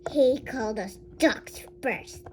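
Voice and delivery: ordinary delivery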